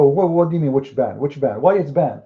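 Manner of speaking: usual speaking voice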